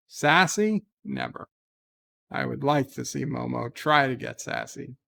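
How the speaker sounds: usual speaking voice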